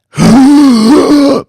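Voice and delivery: loud high gasp for air; huge gasp. Acted. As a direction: gulp in air like coming back to life. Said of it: "took in a massive lungful of air".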